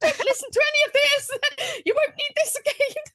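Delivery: high singsong la la la; mocking brain voice. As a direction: drown out the compliments like a child. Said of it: "my brain's going".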